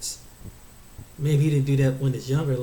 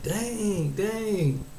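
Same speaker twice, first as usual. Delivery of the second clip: high, drawn-out falling exclamation; mock dismay; 5 semitones higher than usual. Onlooker reacting to an embarrassed lover, mock dismay. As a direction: stretch each word in acted disbelief.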